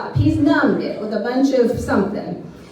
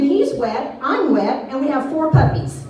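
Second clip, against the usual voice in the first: ordinary talk, nothing marked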